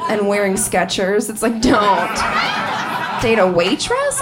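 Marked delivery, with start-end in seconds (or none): 1.6-4.2 DON'T set apart, then WAITRESS rising in disbelief; "waitress" turned up into a baffled question; incredulous repeat. Retold or imitated.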